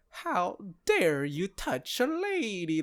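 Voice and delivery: mock Southern belle drawl, slowed; Southern belle indignation, lightly hammed; Southern accent. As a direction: play up an offended Southern lady's accent. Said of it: "she goes full Southern".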